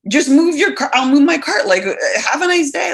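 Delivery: playful exasperated comeback; mock exasperation. Acted exasperated reply to needless apology.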